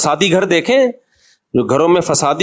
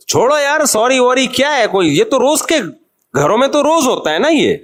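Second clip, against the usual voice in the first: dismissive shrug-off; 7 semitones higher than usual. Acted. Dismissing demands for apology.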